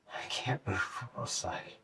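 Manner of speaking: breathless whisper, worn out; exhausted whisper. Retold or imitated. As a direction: barely get the words out. Exhausted retold inner voice.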